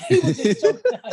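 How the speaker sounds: high, laughing, fast; laughing through the punchline. Real, not acted. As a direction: crack up while saying it. Laughing while telling.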